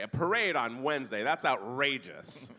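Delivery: mock-scandalized over a harmless parade; mock outrage. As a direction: play the appalled letter writer. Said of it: "an angry letter to the editor".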